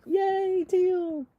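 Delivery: high, drawn-out cheer. Real, not acted. Host cheering Teal's giveaway win, high cheering. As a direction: celebrate the winner in a lifted voice.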